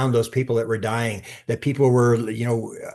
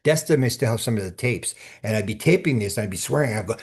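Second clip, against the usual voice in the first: ordinary talk, nothing marked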